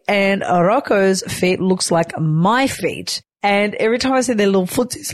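ordinary talk, nothing marked

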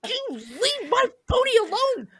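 high, strained yell at the bull; childish yell. Retold or imitated. Little girl yelling at the bull again, high shout.

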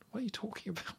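breathless whisper of disbelief. Retold or imitated. Recounting her own reaction to the film, a stunned whisper. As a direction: hush it like you can't believe your eyes.

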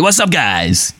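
high-pitched mock American influencer greeting; imitating an upbeat influencer's intro; upbeat American podcaster. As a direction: parody a peppy American podcast host. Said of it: "you can be upbeat and like all these".

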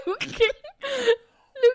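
high, laughing through the name. Real, not acted. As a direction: say his name while cracking up. Laughing at him.

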